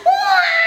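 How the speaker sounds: high, loud fake crying wail; hammed-up child sob; puppet's mock wail. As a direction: wail like a sad little puppet. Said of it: "Tiur menangis".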